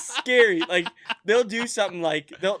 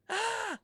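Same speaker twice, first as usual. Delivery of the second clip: very high squeal, softly done; imitated toddler outburst; 6 dB quieter than usual. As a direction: mimic a toddler's sudden high cry. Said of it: "I hear Steven go".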